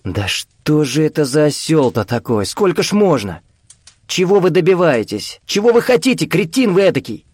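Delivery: high, rising angry outburst; exasperated. A narrator's reading. Виктор в отчаянии ругает старика.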